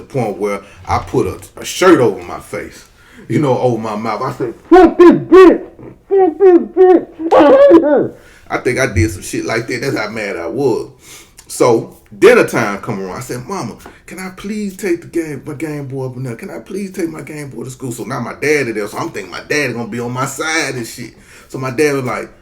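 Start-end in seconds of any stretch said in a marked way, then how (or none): 4.7-8.2 put-on very high, loud, slow stretched kid curse; muffled kid rage. Angry boy curses his mom into his shirt, loud high stretched squeal. 13.4-17.9 a bit higher, soft pleading; begging kid. Retold or imitated. Boy begs mom again at dinner, soft pleading kid voice.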